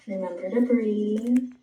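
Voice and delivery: usual speaking voice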